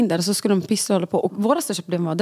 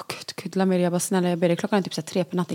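soft, lower begging aside; hushed pleading; 3 semitones lower, 5 dB quieter, faster than usual. Retold or imitated. Mother to partner at three in the night, hushed pleading. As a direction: quietly implore an angry partner to stop.

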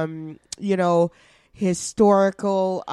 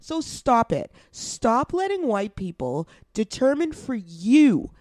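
usual speaking voice

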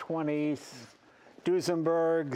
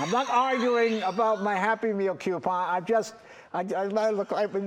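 indignant protest; 7 semitones higher, 5 dB louder than usual. Real, not acted. Denies kid's claim, laughing at himself.

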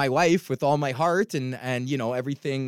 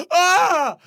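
loud, high wail of agony; exaggerated scream of dismay; anguished mock scream; 9 dB louder than usual. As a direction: let out a high, hammed-up cry. Acts out his reaction to message.

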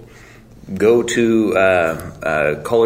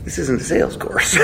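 normal talking voice

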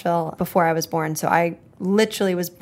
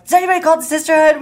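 excited; 8 semitones higher, 6 dB louder, faster than usual. Retold or imitated. Googling the band name excitedly.

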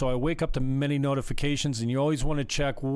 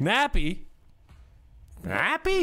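high incredulous echo, climbing; rising disbelieving squeak; shocked disbelief; 8 semitones higher than usual. Real, not acted. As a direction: repeat it like you can't believe it.

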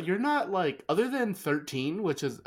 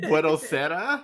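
slow Italian greeting with a Mexican accent; tentative Mexican-accented take, questioning; little Mexican accent; 3 semitones higher, 4 dB louder, slower than usual. Shown as a demonstration. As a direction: try it slowly, lightly Mexican-accented. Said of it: "I just added a little Mexican accent".

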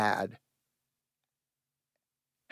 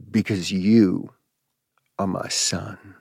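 tender mother; 4 semitones lower, slower than usual. Retold or imitated. Mother holding her son, telling him why, slow and tender. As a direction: gently let her in on a secret. Mother embraces child, reveals secret.

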